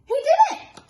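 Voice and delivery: very high, loud parrot shriek. Acted. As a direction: screech the blame. Parrot blames Pete again.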